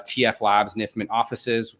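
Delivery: ordinary talk, nothing marked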